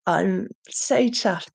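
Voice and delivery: ordinary delivery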